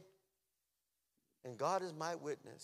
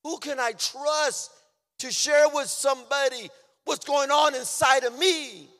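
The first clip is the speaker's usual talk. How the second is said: impassioned preaching; 11 semitones higher, 14 dB louder than usual. Real, not acted. Preacher asking whom he could trust, impassioned cry.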